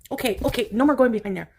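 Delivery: ordinary delivery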